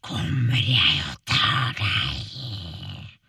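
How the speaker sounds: very low, slow troll growl. Acted. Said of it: "sa trollet".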